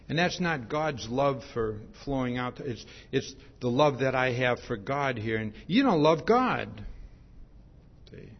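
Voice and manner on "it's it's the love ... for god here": normal talking voice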